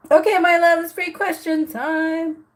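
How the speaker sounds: high, loud upbeat call to viewers; excited announcement. Real, not acted. Calling out to her viewers that questions are open, excited and loud. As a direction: announce it brightly and loud, like opening a show.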